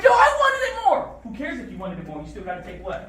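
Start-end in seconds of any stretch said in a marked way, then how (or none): 0.0-1.2 high, loud protest; hammed-up high-pitched insisting; mock insistent shout. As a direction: shout it as an indignant imitation. Mimics someone insisting they wanted it.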